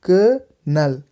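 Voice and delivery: "क-नल" in two separate beats; short "क", pause, then "नल"; syllable by syllable. Shown as a demonstration. Teacher drilling learners, "क-नल" split in two. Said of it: "इस तरह से".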